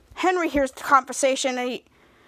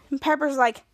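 ordinary delivery, nothing marked

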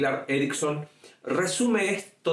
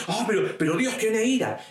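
mock objection; 6 semitones higher than usual. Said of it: "lo primero que dicen es que".

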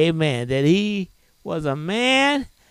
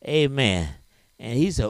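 ordinary talk, nothing marked

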